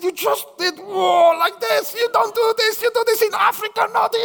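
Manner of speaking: high, insistent scolding; mock indignant complaint; indignant man. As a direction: lecture him on manners. Quarrel with Nigerian man.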